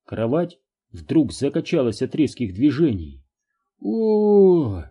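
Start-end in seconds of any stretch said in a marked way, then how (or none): 3.8-4.8 sudden high yelp of shock; startled cry. A narrator's reading. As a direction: blurt it out in alarm. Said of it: "вырвалось у неё".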